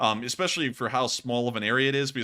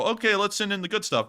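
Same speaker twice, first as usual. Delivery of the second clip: high, mocking voice for the Russian brass; lightly voiced commander, mocking; 8 semitones higher than usual. Mocking the Russian commanders, high sneering voice.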